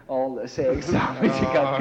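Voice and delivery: laughing, slow and loud; real laughter. Real, not acted. Telling the interviewer about the beating, laughing. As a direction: laugh through the grim punchline.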